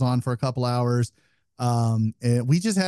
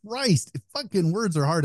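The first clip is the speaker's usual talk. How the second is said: higher-pitched exasperation after a stumble; exasperated at self; 6 semitones higher, slower than usual. Real, not acted. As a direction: curse your own tongue-tied slip, pitched up. Exasperated at own stumble over a name.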